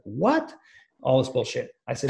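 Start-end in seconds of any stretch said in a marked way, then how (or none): 0.0-0.5 surprised what. Retold or imitated. Stepsister hears he was left.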